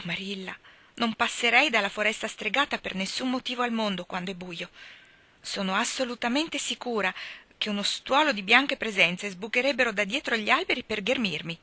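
usual speaking voice

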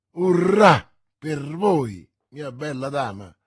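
slow, loud hurrah, then dropping back; shouting. A narrator's reading. Ship's captain, promised a thousand pistoles, to Milady: loud drawn-out cheer. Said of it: "gridò il capitano".

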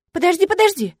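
high, loud hurried stop; eager. A narrator's reading. Witch halts the demon, eager to hear the legend. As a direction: jump in eagerly.

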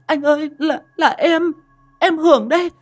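high, tearful pleading; girl crying to her possessed lover. Acted. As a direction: plead through tears with your lover. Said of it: "con bé Hường khóc toáng lên".

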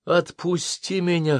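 gasping for air. Acted. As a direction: shout it while gasping. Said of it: "хватая ртом воздух, крикнула Дженнифер".

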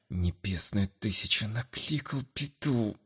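soft, slow annoyed mutter; cursing under breath. A narrator's reading. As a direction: grumble the curse to yourself. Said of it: "С досадой пробормотал себе под нос".

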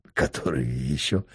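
a weak, tired smile in the voice; faint smile. A narrator's reading. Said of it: "слабо улыбнулся". (тихо, со слабой улыбкой)

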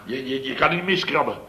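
ordinary talk, nothing marked

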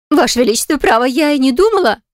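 high, frightened babble; babbling. A narrator's reading. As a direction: stammer out excuses. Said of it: "лепет придворной целительницы".

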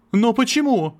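exclaiming. Acted. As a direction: cry out why. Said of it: "воскликнул Мартин". (отчаянно воскликнув)

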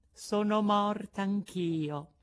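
high, thin voice from another world; a small girl sounding already dead; ghostly little voice. A narrator's reading. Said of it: "con una vocina che pareva venisse dall'altro mondo". (vocina dall'oltretomba)

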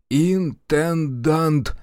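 very slow, high, self-important enunciation; syllable by syllable. A narrator's reading. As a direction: draw out each syllable of the title. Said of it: "по слогам произнёс Аверкин". (по слогам, важно и высоко)

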